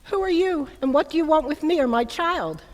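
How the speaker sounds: high, loud, startled demand; alarmed cry. Acted. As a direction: shout it out in fright to protect the child. Startled mother confronts stranger.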